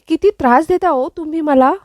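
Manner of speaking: high, playful annoyed complaint; mock irritated. A narrator's reading. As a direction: scold him in teasing irritation. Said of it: "Kavya लगेच चिडून म्हणते".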